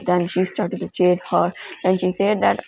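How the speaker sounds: ordinary delivery, nothing marked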